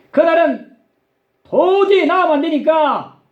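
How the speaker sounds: high-pitched, insistent acted warning; retold stern prohibition, pitched high; urgent warning. Acted urgent warning about a birth date.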